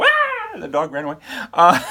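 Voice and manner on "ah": imitated dog yelp. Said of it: "I made the dog go".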